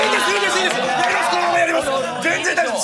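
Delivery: high, loud, fast protest; flustered refusal. Retold or imitated. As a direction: hurriedly wave off the MC. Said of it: "えー". (慌てて断る声)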